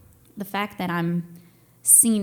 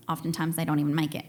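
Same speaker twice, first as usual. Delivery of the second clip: normal talking voice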